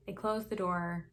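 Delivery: usual speaking voice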